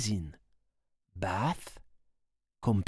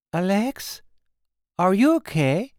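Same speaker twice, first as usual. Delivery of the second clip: higher, anxious call of a worried mother; mother checking on the girl, worried and higher; 7 semitones higher, 3 dB louder than usual. Acted. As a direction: call out for Alex, concerned. Caroline calls out, worried.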